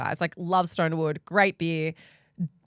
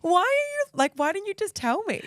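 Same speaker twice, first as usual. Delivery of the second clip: exasperated; 12 semitones higher than usual. Retold or imitated. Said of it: "I was like".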